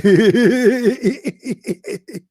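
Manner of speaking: high mocking laugh. The caller laughing at the Bible, high and mocking.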